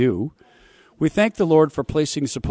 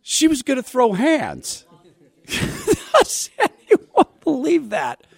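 mocking disbelief; 4 dB louder, slower than usual. Real, not acted. Mocking a claimed fist fight.

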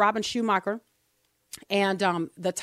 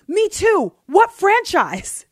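higher and louder, keen questioning; second man voiced eager and loud; eager stranger; 9 semitones higher, 7 dB louder, much slower than usual. A narrator's reading. Voicing second man in joke.

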